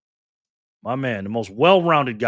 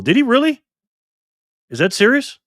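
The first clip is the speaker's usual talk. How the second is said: surprised; 13 semitones higher, 10 dB louder than usual. Real, not acted. Reacting to Trump Mobile news.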